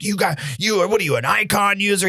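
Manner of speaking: retold heated questioning; fired up. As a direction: imitate an irate landowner sputtering accusations. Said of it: "He gets all fired up".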